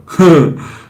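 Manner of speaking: high loud laugh; burst of laughter. Real, not acted. Laughing at Jocko mixup.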